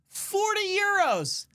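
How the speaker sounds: high, drawn-out exclamation; incredulous high wail; outraged repeat. Acted.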